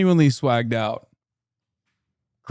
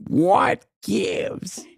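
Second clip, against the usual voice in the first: higher Midwestern what gives; imitating her accent; 6 semitones higher than usual. Host imitates the candidate's accent again. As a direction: tease the bad accent. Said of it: "You have a terrible accent".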